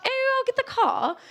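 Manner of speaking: hammed-up posh British, "car" as "cah"; bad posh British accent. Shown as a demonstration. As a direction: put on an exaggerated posh British voice. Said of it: "really bad posh accent".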